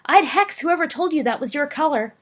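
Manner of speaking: a boy mocking his cousin's pink robes; taunting shout. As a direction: jeer at her loudly across the hall. Taunting cousin across hall.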